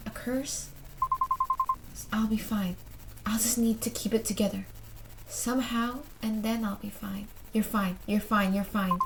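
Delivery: softly voiced inner thought; quiet self-reassurance. Character trying to keep it together, hushed self-reassurance. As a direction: murmur it softly to oneself.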